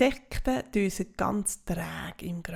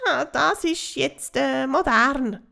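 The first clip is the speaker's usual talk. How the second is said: higher, louder, slow grunting bug voice; grunting cockroach; 4 semitones higher, 7 dB louder, slower than usual. Acted. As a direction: fumble the excuse with a smug grunt. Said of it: "grunzt die Schabe".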